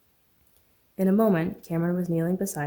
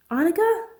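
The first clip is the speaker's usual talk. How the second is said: high, loud call; 12 semitones higher, 6 dB louder than usual. Acted. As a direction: shout to find her. Second man calling out.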